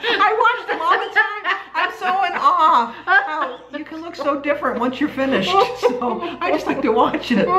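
high, giggly, laughing through words. Real, not acted. Friend laughing at her.

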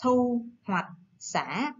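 "Thu hoạch sả" stretched very slowly, each syllable apart; very slow model word. Shown as a demonstration. Teacher modelling "Thu hoạch sả" for pupils to repeat. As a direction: lead the class through "Thu hoạch sả" slowly. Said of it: "Các bạn đọc lại theo cô nào".

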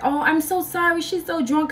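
put-on smooth it over as the drunk girl's friend; mock apologetic friend. Girlfriend's friend apologizing, retold.

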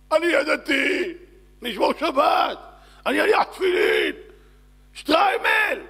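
high, wailing shouted plea; desperate screaming. Acted. Man trapped in burning flat begs God to live, desperate acted screaming. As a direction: bargain for life at full scream, breaking into wails. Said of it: "צועק!".

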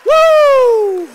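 long, high, loud whoop; jubilant whoop. Real, not acted. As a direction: let out a celebrating holler. Preacher celebrates the miracle.

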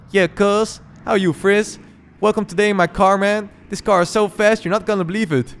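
high, loud put-on voice for the friend; voicing Jason. Player voices Jason welcoming him into the fast car, high put-on voice. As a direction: play the hyped driver bragging about his car.

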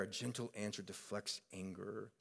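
preacher models calming a flaring temper with softness; gentle answer. Shown as a demonstration. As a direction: say it gently, like a soothing balm. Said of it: "with our tone".

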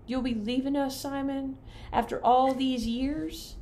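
a fond aunt dabbing her eye, drawn out; teary Aunt Mabel. Acted.

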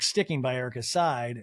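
normal talking voice

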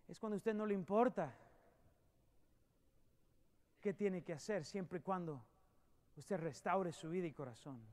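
soft, low, gentle invitation; a preacher's hushed altar call; quiet pleading appeal. Acted. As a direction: coax the listener to surrender.

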